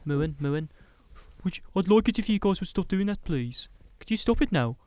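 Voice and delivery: high, mock-Brummy pleading; Brummy accent. Friend imitating Jack asking them to stop, mock Brummy accent. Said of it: "Jack goes in his Brummy accent".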